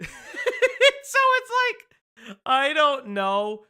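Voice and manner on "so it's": laughing. Real, not acted. Laughs at own joke.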